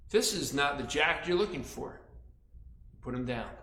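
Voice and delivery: plain reading voice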